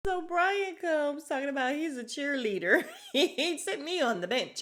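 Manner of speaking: high, loud laughing retelling. Real, not acted. Laughing while recapping Brian.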